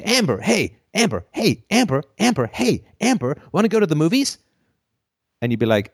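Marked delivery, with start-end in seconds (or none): 0.0-4.4 high, eager calling out; sing-song calling to Amber, pitch way up; pushy suitor. Retold or imitated. Acted persistent asker.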